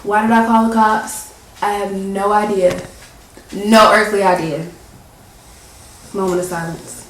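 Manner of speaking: hushed, low, rueful admission; quietly baffled at yourself, with long pauses; mock-solemn regret. Real, not acted. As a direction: drop to a soft, low voice, as if mourning your own mistake. Mock solemn regret, moment of silence.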